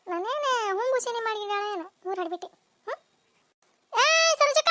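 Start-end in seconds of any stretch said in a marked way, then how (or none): 3.9-4.7 yelled summons. Acted. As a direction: holler for the neighbour from outside her door. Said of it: "ಹೆಂಗೆ ಚೀರಾಕತ್ತೈತೆ".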